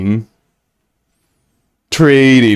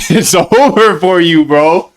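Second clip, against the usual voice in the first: raised, slow, drawn-out mock threat; emphatic mock warning; 5 semitones higher, 3 dB louder, slower than usual. Streamer warning fans who greet him in public, escalated mock threat. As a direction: stretch it out high with playful menace.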